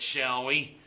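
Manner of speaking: ordinary delivery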